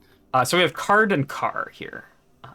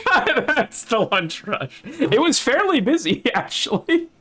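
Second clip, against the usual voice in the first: laughing; 10 semitones higher, 5 dB louder than usual. Real, not acted. Laughing at story.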